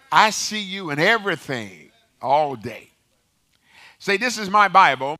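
loud, high-pitched pulpit call; rallying preacher. Real, not acted. Preacher leading the congregation's Bible confession, loud rallying call. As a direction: ring it out to the whole congregation.

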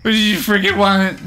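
a hammed-up drunk yelling goblins; mock drunk guy. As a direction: play the flustered drunk shooing you off. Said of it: "and he went".

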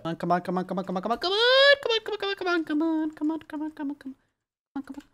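rapid-fire high, soft pleading; frantic urging. Real, not acted. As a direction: repeat it anxiously, climbing in pitch. Frantic urging during a jump.

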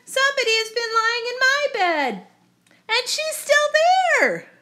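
very high little-bear squeal, quick then stretched; crying out. Acted. Baby Bear finding someone in his bed, very high squealed cry. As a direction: squeal it, dragging out the last words. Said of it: "cried Baby Bear".

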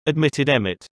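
usual speaking voice

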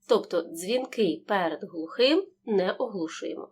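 usual speaking voice

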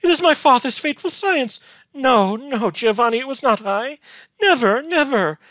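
high, loud desperate protest; anguished, impassioned heroine's outcry; shrieking. A narrator's reading. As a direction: plead frantically, voice held very high. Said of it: "shrieked Beatrice".

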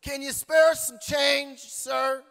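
high, loud, slow plea for change; a lightly voiced pleading stranger; slow high beggar asking for change; beggar's voice. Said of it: "the voice says".

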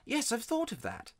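put-on high-pitched boy's answer; boy replying. A narrator's reading. Digory answers Polly.

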